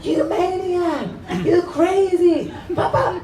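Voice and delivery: high, drawn-out outraged cursing; hammed-up indignant shouts without much volume; retold cursing. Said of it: "he started to curse".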